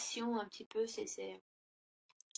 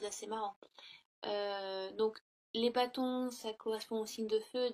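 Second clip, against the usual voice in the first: ordinary talk, nothing marked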